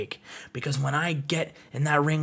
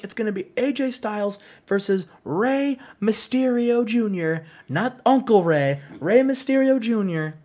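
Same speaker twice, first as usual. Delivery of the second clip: Billy Kidman voice; 6 semitones higher than usual. Retold or imitated. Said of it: "And he says".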